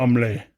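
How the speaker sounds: "Omelette" as French "om-LAY", silent H and T; French pronunciation. Shown as a demonstration. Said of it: "in French".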